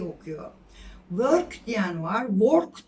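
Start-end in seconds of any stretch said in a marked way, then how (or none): 1.1-1.7 "Work" as "vörk", Turkish v and r; loud, slow "Work" with ö and a sounded r; wrong work, r sounded. Shown as a demonstration. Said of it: "ama ikisi de değil". 2.3-2.9 "work" as "vork", o vowel and hard r; wrong work, as spelled. Shown as a demonstration. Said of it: "ama ikisi de değil".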